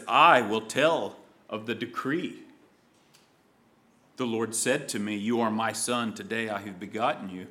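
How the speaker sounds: 1 hushed solemn voice of the anointed one. Acted. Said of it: "The anointed one says".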